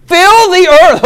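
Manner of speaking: high, loud incredulous repeat; amused exclaim. Acted. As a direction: echo the order in disbelief. Repeats command, much higher, louder.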